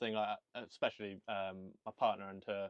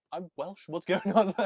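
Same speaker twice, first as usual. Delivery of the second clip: put-on high-pitched Birmingham accent, louder at the end; slipping into Brummy; 7 semitones higher, 9 dB louder, slower than usual. Welsh speaker catching himself talking Brummy, mock Birmingham accent. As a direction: protest in a raised, put-on Brummy lilt. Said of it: "I'll catch myself doing it".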